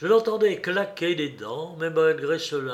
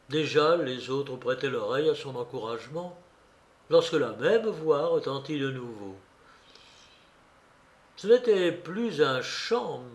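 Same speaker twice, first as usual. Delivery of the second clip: plain reading voice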